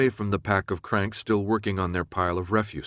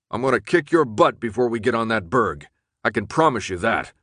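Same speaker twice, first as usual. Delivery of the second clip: plain reading voice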